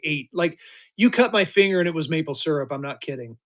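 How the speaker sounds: normal talking voice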